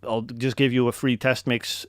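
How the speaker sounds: ordinary talk, nothing marked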